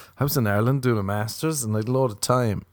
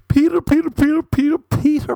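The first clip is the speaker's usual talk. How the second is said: high, louder playful chant of Peter; silly singsong; 5 dB louder than usual. Pretend. Joking about guys petering out, high silly chant. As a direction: sing Peter like a goofy call.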